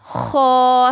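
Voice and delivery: खो held long with throaty kh; slow kho. Shown as a demonstration. Teacher holding the kho sound for students. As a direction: stretch खो out slowly. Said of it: "ठीक है, ये".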